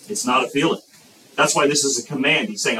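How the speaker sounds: ordinary talk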